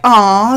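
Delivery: high, loud little aw of delight; touched aw. Retold or imitated. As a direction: coo like a moved little girl. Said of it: "she's like".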